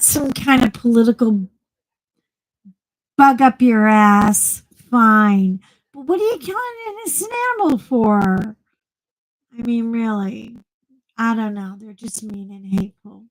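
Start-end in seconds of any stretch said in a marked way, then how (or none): 5.9-8.6 high, fast, indignant outburst; outraged. Real, not acted. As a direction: fire the question out in disbelief. Ranting about cruelty to dogs.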